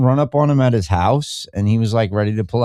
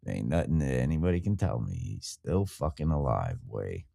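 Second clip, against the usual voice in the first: low, soft muttering; muttered; 12 semitones lower, 13 dB quieter than usual. Real, not acted. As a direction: mutter it under your breath. Drops to a mutter.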